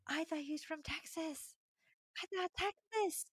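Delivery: small, high-pitched squeak; sheepish confusion. Real, not acted. Confused, small-voiced admission.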